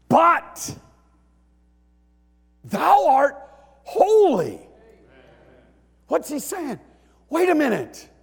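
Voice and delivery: high, full-force preaching shout; ringing shouted declaration; emphatic proclamation. Retold or imitated. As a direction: proclaim it loudly with big pauses. Preacher shouts the psalmist's turn.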